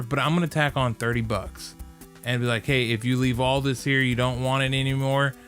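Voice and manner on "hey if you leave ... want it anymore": usual speaking voice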